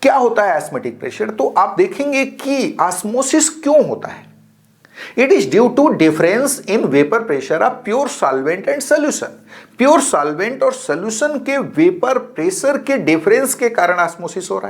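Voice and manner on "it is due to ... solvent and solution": slow, deliberate dictation pace; dictation reading. Acted. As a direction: spell out the definition word by word for notes. Teacher reads definition slowly.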